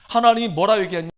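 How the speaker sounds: normal talking voice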